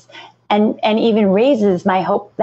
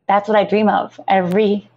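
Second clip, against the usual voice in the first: ordinary talk, nothing marked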